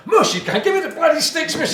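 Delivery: high, loud, squeaky mock customer; excited customer voice. Shopkeeper mimicking a keen customer, high and loud. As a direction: ham up the pushy punter. Customer over-enthusiastic at demo.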